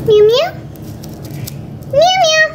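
high, pert cat mew. Acted. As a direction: mew like a pretend kitten. Plays the cat character.

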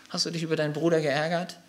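usual speaking voice

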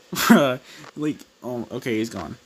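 ordinary delivery

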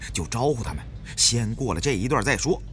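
ordinary delivery, nothing marked

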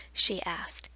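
usual speaking voice

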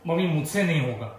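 low-pitched refusal to mom; lightly acted unwilling son; reluctant son. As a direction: turn it down in a low voice. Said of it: "एकदम सही बोला".

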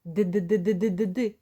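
quick tapped д, д, д; repeated d sounds. Shown as a demonstration. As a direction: tap the tongue on д again and again. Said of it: "Да, ось так". (швидке повторення д, д, д)

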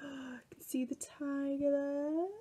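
hushed, secretive whisper; awed whisper. Acted. Showing children the tiger on the cover, in an awed whisper.

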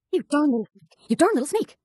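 high, loud furious scolding of a little brother; angry scolding. Acted. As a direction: snap at the boy who sneaked after her. Sister catches brother sneaking in barn.

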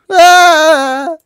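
high, loud drawn-out scream. Acted. Heroine finds a dove staring, high scream. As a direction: let out a long high shriek.

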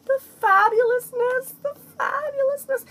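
very high, loud falsetto squeal of admiration; high theatrical gushing. Pretend. Vlogger admiring the packaging, high theatrical gushing. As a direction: sing out your admiration way up high. Gushing over the product's look.

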